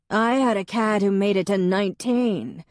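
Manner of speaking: a booming neighbor sharing a pet story. A narrator's reading. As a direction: boom it across the room. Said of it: "in her booming voice".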